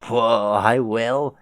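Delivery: drawn-out baffled whoa; surprised stoner. Acted. Clown troll told to stop.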